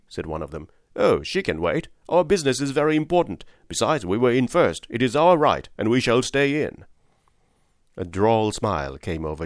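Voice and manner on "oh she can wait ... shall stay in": insistent, dismissive passenger; self-important gentleman. Acted. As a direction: claim the cab as your right. Pushy gentleman claims the cab.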